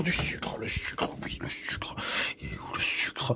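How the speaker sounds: hushed whisper hunting for the sugar. Pretend. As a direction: whisper as if sneaking around the kitchen.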